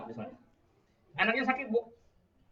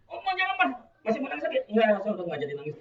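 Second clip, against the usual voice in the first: high, loud comic dialogue; acted tantrum exchange; 7 semitones higher, 6 dB louder, faster than usual. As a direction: play out the scene in a raised voice. Acted scene of crying child left behind. (adegan anak ngambek, suara tinggi)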